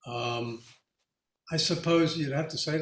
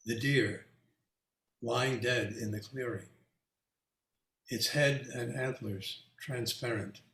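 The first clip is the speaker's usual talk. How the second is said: poem recitation; 3 semitones lower, 5 dB quieter than usual. Shown as a demonstration. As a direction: read it softly like a poem. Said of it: "recite a poem".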